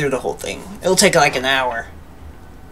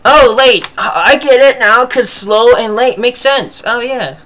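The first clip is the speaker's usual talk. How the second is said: high eager realization; light up with sudden understanding; excited realization; 6 semitones higher, 4 dB louder than usual. Real, not acted. Excited realization about joke.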